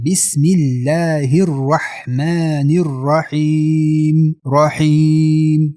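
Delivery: slow basmala chant with very long 'رحیم'; elongated madd. Shown as a demonstration. Teacher models stopping elongation, long chanted vowel. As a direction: hold the ee of 'رحیم' up to six counts.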